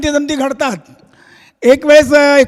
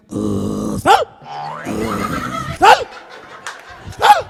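dog yelps; much slower than usual. Acted. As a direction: yip like an eager little dog. Dog begging to go along.

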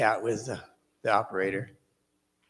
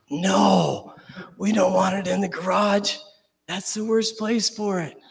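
higher, louder alarmed cry; alarmed protest; 7 semitones higher, 4 dB louder, slower than usual. Retold or imitated. Snake slipping into the garage, a high alarmed protest. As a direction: plead against the worst outcome.

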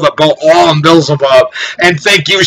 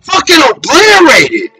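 higher-pitched furious preaching shout; angry rant; 10 semitones higher than usual. Real, not acted. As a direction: rail at them, voice pushed up high. Ranting against building up nations.

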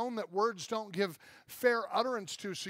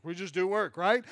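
ordinary talk

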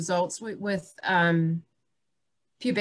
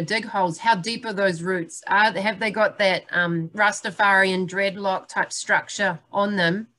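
ordinary delivery

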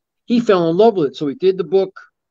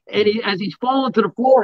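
ordinary talk, nothing marked

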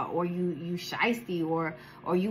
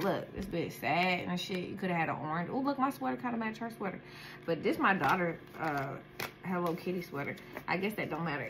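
quiet aside; 3 semitones lower, 6 dB quieter than usual. Real, not acted. Muttering aside about the card.

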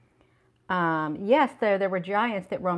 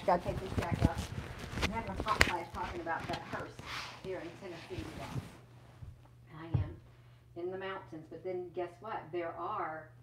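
muffled and far away, mic under the coat; 14 dB quieter than usual. Real, not acted. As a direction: talk as if the mic is buried in a jacket. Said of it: "It went muffled".

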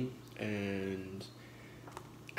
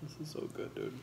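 whispering; 6 semitones higher, 6 dB quieter, faster than usual. Real, not acted. Whispered while eating.

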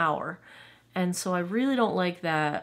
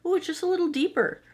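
high excited rush; 11 semitones higher, faster than usual. Real, not acted. Gushing over deeper shade.

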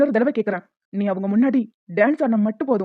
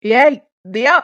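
louder call across the room; 4 dB louder, much slower than usual. Acted. Lender calls out to Diya, loud. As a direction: call her name sharply. Calling her from afar.

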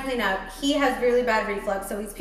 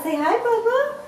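high-pitched motherly cooing; 12 semitones higher, 5 dB louder than usual. Real, not acted. Mother coaxing her baby.

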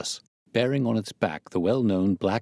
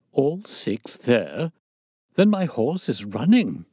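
incredulous, then raised and urgent; agitated colonel's cry; great agitation; 5 semitones higher, 4 dB louder, slower than usual. A narrator's reading. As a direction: start in slow disbelief, burst into excitement. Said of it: "cried the Colonel in great agitation".

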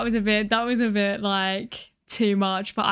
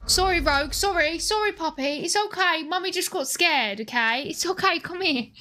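soothing pet voice; 7 semitones higher than usual. Real, not acted. Soothing scared dog.

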